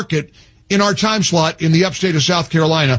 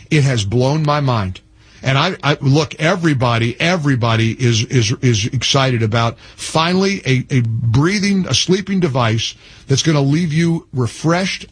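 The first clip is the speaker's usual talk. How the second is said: low, relaxed host ad read, a touch lower than his rant; radio ad read; 6 semitones lower than usual. Acted. Host pitching a mouthpiece sponsor to his radio audience, easy ad read. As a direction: sell the sleep device casually to listeners.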